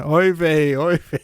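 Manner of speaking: a hint of an old man's lament.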